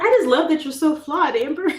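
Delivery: high, loud, overly sweet fan voice; mock gushing. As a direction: gush fakely. Mocking fans' backhanded compliments.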